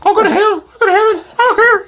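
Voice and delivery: high squeaky sobbing bird, fast. Acted. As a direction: wail it like a tiny distraught bird. Bird PD keeps ranting.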